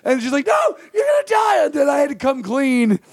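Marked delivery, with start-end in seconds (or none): 0.5-1.6 very high mock-frantic cry; imitated panicking cousin. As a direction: shriek the warning like an alarmed relative.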